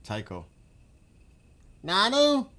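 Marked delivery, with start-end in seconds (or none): 1.8-2.5 much higher, louder coo to the cat; pet voice. Real, not acted. Owner coos to the pet wanting into the room, high pet voice. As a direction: call the pet sweetly, high up.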